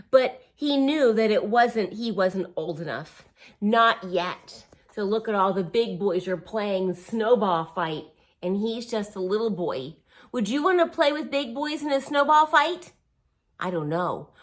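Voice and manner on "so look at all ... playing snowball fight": ordinary delivery, nothing marked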